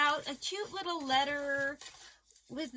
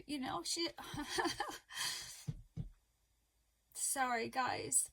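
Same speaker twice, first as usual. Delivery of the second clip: getting emotional; 6 dB quieter than usual. Real, not acted. Vlogger moved by her friend's cards, really choking up. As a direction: voice gives way to a tearful breath. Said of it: "I'm gonna get emotional".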